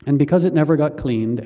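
ordinary delivery

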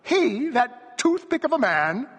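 very high, loud disbelief; scornful cry. A narrator's reading. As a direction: cry out in mocking amazement. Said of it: "the bareback rider cried".

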